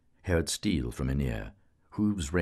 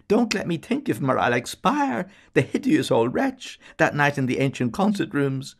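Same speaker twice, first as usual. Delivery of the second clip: high, loud, squealing plea; a barmaid begging not to recall him; pleading prayer; 11 semitones higher, 7 dB louder than usual. A narrator's reading. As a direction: beg like you'll faint. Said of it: "but prayed again".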